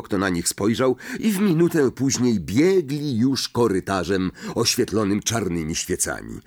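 ordinary delivery, nothing marked

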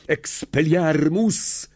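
high, drawn-out cry; shouted spell. A narrator's reading. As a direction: cast the spell with a stretched cry. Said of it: "krzyknął". (okrzyk zaklęcia, przeciągły)

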